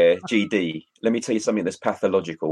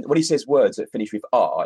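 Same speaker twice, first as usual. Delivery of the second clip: usual speaking voice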